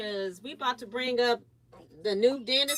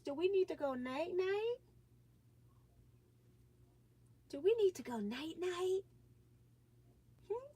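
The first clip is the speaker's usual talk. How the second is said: high, soft sleepy-time coo; 7 semitones higher, 5 dB quieter than usual. Real, not acted. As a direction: ask gently like bedtime. Asking sleepy baby.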